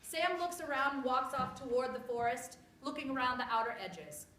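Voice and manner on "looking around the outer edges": ordinary talk, nothing marked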